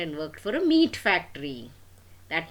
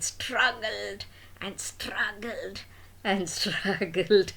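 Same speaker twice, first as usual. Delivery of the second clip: straining; 3 semitones higher, slower than usual. A narrator's reading. Storyteller acting the struggle.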